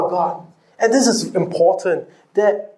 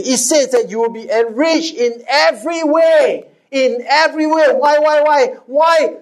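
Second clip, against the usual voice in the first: preaching fervour; 5 semitones higher than usual. Real, not acted. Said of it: "preaching at full force".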